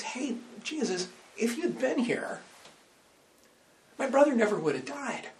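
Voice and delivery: high pitched exasperated complaint. Retold or imitated. Martha to Jesus, grieving her brother, exasperated. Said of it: "listen to the exasperation in her voice".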